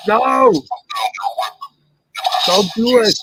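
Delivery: loud high mock plea; shouting. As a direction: yell it in hammy panic. Mock plea in toy scene.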